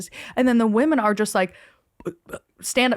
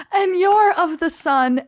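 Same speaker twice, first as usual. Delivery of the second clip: mock grand pronouncement; 9 semitones higher, 4 dB louder than usual.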